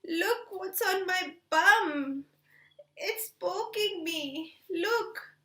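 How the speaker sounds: slow pleading pig. Acted. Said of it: "So Popo said".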